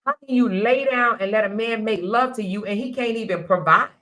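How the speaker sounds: usual speaking voice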